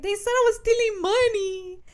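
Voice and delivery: high, whiny fake wail; mock crying. Woman telling boyfriend of the accusation, mock crying. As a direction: wail it like a hammed-up sob story.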